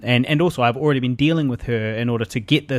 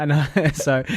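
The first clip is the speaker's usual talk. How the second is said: usual speaking voice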